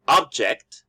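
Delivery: OB-ject, slow with a falling first-syllable stress; noun stress. Shown as a demonstration. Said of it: "it's a noun".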